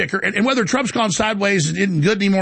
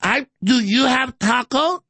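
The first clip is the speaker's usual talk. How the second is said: Kamala Harris; 6 semitones higher, slower than usual. Acted.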